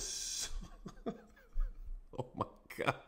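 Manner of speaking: words collapsing into breathless laughter; helpless laughter. Real, not acted. Officer reacting to the replayed takedown, cracking up. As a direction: trail off as the laugh takes over.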